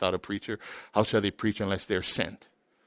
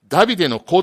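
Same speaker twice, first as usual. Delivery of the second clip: loud, higher cry of the title; 7 semitones higher, 11 dB louder, much faster than usual. Retold or imitated. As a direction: shout the name like a plea. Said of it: "って叫んだ".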